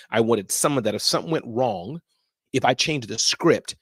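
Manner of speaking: ordinary delivery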